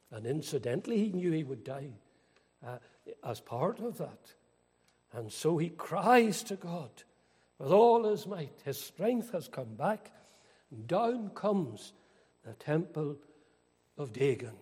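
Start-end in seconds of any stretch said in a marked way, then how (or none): 5.1-14.6 loud, slow pulpit climax; slow, swelling preacher's roar; thundering preaching. Acted. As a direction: drag out the falling temple with all your might. Climax, temple falls.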